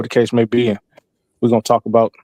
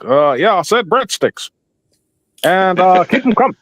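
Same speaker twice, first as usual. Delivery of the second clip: slightly higher, measured presidential cadence; Obama impression; 4 semitones higher, slower than usual. Comedian ordering from a waiter in a lightly done Obama voice. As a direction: order breadsticks as Obama would, unbothered. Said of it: "I'll order my food in his voice".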